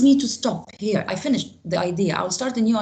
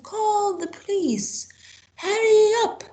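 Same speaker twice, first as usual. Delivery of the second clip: urgent shout; 13 semitones higher, slower than usual. Shown as a demonstration. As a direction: yell it like an emergency. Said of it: "when I am in a hurry".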